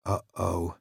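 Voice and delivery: soft, low whisper. Acted. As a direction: breathe it out quietly. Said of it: "Danny whispered".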